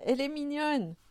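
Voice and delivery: high-pitched, admiring little cry; delighted exclamation. A narrator's reading. Moumine finding the visible child cute, exclaiming delightedly.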